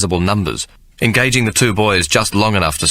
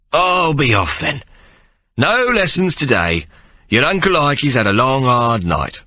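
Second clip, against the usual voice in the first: tired Uncle Ikey; 6 semitones higher than usual. Acted. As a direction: shoo them off after a long night. Ikey dismisses boys, tired.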